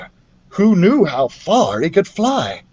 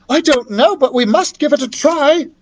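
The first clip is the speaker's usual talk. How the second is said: put-on young girl voice; 11 semitones higher than usual. A narrator's reading. Young character replies.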